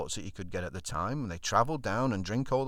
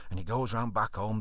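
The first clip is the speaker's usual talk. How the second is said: usual speaking voice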